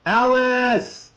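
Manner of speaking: high, loud cry of a name; high screamed call, falling at the end; terrified scream. A narrator's reading. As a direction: scream her name as the crash comes. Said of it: "Dan screamed".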